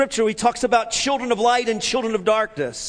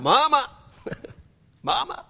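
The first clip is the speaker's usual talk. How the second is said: crying out for mama; 4 semitones higher than usual. Retold or imitated. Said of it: "I started crying out".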